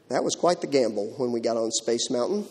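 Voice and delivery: normal talking voice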